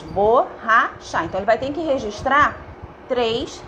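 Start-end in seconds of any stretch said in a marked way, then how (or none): 0.0-1.2 very slow, bo-rra-cha split into three beats; syllable by syllable. Shown as a demonstration. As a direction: count out each syllable of "Borracha" slowly. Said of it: "Eu tenho três sílabas".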